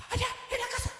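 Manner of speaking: very high, faint gasping squeak; hammed-up breathless fright; mock trembling. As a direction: gasp it out as if terrified. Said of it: "Y llegó corriendo, temblando".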